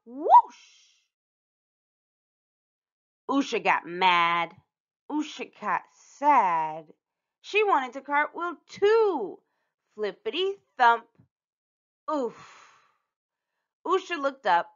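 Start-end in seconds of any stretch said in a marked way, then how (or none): 0.1-1.0 loud, high imitated whoosh; big playful whoosh; airy whoosh. Sound of the cartwheel's end. 12.1-12.9 soft puff of air on landing; short thudding oof; winded oof. Acted. Usha lands from a cartwheel.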